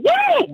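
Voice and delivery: high whooping cheer; real excited whoop; excited woo. Real, not acted. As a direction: whoop with joy. Host hyping up the call.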